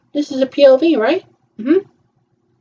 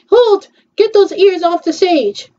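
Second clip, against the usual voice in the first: bossy ringmaster; 7 semitones higher, slower than usual. Pretend. Shouted order, voiced.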